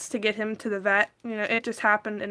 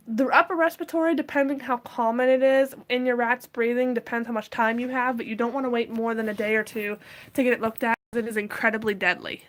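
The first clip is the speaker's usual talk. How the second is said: usual speaking voice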